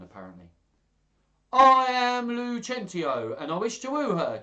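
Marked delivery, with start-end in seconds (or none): 1.5-4.4 Tranio posing as master. Acted. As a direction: announce a false name with grand flourish. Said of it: "Tranio lied smoothly".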